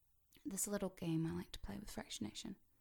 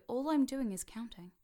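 normal talking voice

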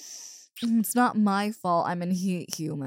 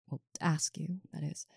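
shy whisper; 2 semitones lower, 9 dB quieter than usual. Acted. Shy correction trailing off.

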